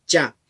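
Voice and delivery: "cha" with a crisp ch and an open a; correct pronunciation. Shown as a demonstration. Showing viewers the correct Quichua "cha". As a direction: model "cha" once, firmly, as the right way. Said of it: "esa es la pronunciación correcta".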